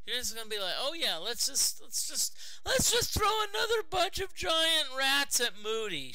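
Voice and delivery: loud, high mocking rant at the game; exasperated sarcastic shout. Real, not acted. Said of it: "a bit exasperated".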